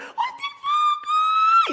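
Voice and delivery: very high slow shriek; mock high squeal as the spotlight falls. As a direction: shriek in sudden fright. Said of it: "y el foco dice".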